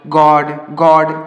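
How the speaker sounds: गॉड, गॉड stretched very slowly with the open aw vowel; very slow model pronunciation. Shown as a demonstration. Teacher modelling the in-between sound for children very slowly. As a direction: drag out गॉड twice, in-between o and aa. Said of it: "बीच वाला जो होता है".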